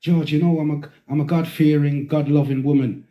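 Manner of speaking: nice, pious Irish woman voice; put-on Irish accent, starting off gently; gentle Irish lady. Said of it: "will start off nice and gently".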